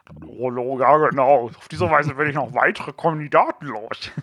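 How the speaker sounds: disguised voice. Pretend. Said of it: "mit verstellten Stimmen".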